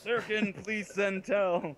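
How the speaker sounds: high, drawn-out announcer call; mock PA page. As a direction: page someone over a pretend PA. Pretend loudspeaker page to a player.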